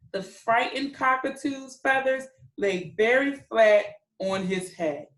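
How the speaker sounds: normal talking voice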